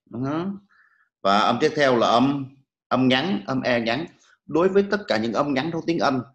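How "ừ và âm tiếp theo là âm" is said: ordinary delivery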